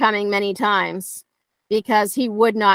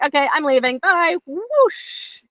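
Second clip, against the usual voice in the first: rising higher and higher, playfully leaving; breezy fake exit; 7 semitones higher, slower than usual. Trainer teasing a dog into following, rising playful. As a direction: sing it out like you're walking off on the dog. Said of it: "I'm just like".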